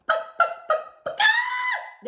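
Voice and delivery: very high mocking chicken clucks; chicken clucking. A narrator's reading. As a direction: cluck like a chicken to mock him. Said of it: "flapping his arms like chicken wings".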